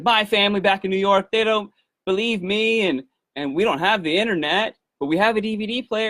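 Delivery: high, rushed and eager; excited mom. Retold or imitated. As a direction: gush about the family. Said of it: "she was so excited".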